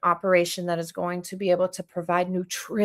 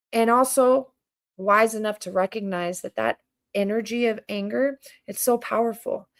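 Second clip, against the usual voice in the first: ordinary talk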